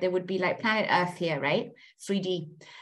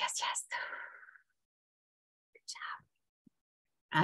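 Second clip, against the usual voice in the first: whisper; 2 semitones lower, 11 dB quieter, much slower than usual. Real, not acted. Quiet praise after affirmation.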